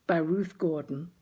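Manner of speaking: ordinary delivery, nothing marked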